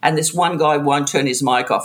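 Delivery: ordinary talk, nothing marked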